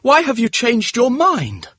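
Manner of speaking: high, drawn-out plea; agitated cry. A narrator's reading. Dorian challenging the painter's change of mind, crying out. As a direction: wail the question, high and slow. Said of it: "he cried".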